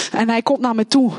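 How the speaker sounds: ordinary talk, nothing marked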